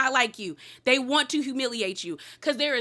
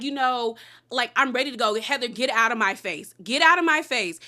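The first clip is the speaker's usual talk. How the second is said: ordinary talk, nothing marked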